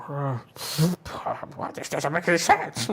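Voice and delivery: put-on frazzled old dog folk muttering to himself. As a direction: grumble over the notes like a confused old sea dog. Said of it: "and he's like".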